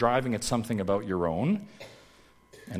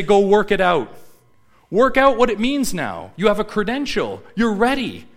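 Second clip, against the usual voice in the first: loud, high charge to new graduates; rousing send-off; 9 semitones higher, 9 dB louder than usual. Acted. Handing graduates their degree, a loud, high send-off. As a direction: send them out with punchy, raised voice.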